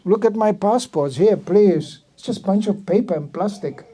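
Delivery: normal talking voice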